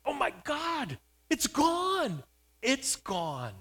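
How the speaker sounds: high, loud cry dropping on the last repeat; astonished shriek. Retold or imitated. Mother looking over at her healed son, shrieking in astonishment. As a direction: shriek it in amazement, then let the pitch fall. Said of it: "she shrieked out in astonishment".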